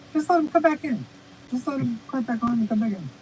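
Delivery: high squeaky helium voice. Real, not acted. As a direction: sounds like a gulp of helium. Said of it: "now you sound like you held helium".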